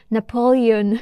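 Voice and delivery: Napoleon said the English way, stress on -le-, final -on with no nasal; English accent. Shown as a demonstration. French teacher imitating the English film actors' Napoleon, English accent. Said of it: "avec un accent anglais".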